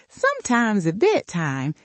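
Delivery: ordinary delivery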